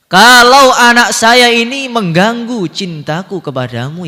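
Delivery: loud, high plea to God; impassioned prayer. Acted. Preacher voices Ibrahim praying about his son, loud impassioned plea. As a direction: cry it out with full preaching force.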